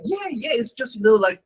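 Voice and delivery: lightly mocking meek disciples; high timid students. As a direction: hedge nervously, voice high and small. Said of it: "And they're like".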